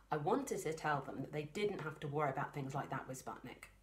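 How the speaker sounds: ordinary delivery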